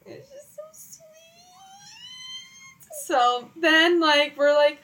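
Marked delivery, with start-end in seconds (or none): 0.1-1.0 tiny, very high squeak; choked up. Real, not acted. Telling friend about the proposal, choked up. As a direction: voice vanishes into a soft, tearful squeal. Moved while recalling the proposal.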